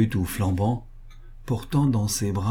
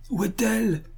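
called-out question; 13 semitones higher than usual. A narrator's reading. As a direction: call out from the crowd, pitched high. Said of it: "lui cria-t-on".

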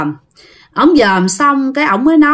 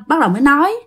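ordinary delivery

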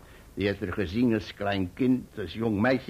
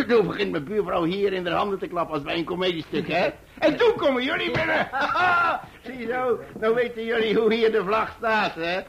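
high, loud and fast, full of laughter; roaring with happiness at the end; laughing with delight; 11 semitones higher, 5 dB louder than usual. Acted. Proud uncle finishing his story, laughing loudly. Climax of the joyful tale.

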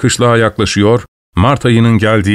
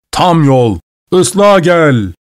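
shouting; 6 semitones higher, much slower than usual. A narrator's reading. Old watchman shouting at the commotion from his door, climbing yell. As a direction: holler the order out the door, climbing in pitch. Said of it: "diye bağırıyordu".

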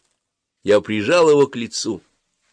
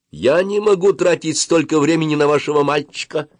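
higher, ringing declaration; 8 semitones higher, 4 dB louder than usual. A narrator's reading. Dentist striking a majestic pose before mother, ringingly. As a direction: proclaim it grandly, voice pitched up. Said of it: "звонко произнесла врачиха".

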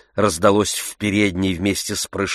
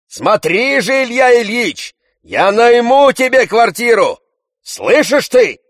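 Tarantyev shouting; 7 dB louder than usual. A narrator's reading.

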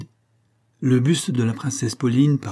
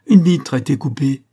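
excited shout; 3 semitones higher, 7 dB louder than usual. Acted. Kitchen boy discovers cut glass.

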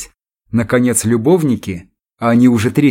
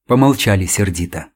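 ordinary delivery, nothing marked

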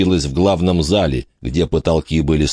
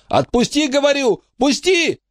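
put-on very high, frantic child's demand; boy tries to break free and run back in, frantic; desperate boy. A narrator's reading. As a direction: wrench free, beside himself. Boy struggling to run into fire.

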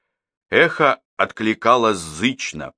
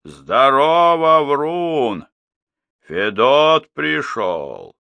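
loud, slow hollered greeting; drawn-out shout; 4 semitones higher, 3 dB louder, much slower than usual. A narrator's reading. Old man calling to the echo again, drawn-out shout. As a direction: holler it long, stretching each vowel. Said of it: "крикнул снова старик".

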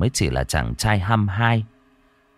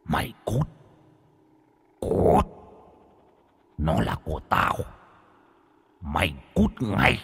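dark whisper behind you; 4 dB quieter than usual. A narrator's reading. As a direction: hiss it like a vengeful ghost. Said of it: "âm thanh rít lên".

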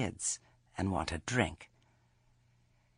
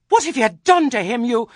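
much higher, loud accusing cry; furious demand; 14 semitones higher, 14 dB louder than usual. A narrator's reading. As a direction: hurl it at her in alarm. Grandmother confronts over hurt child.

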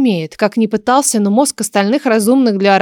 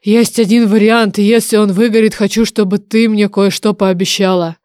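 bargaining dragon oracle. Acted. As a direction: propose a deal with weight. Oracle offers a deal.

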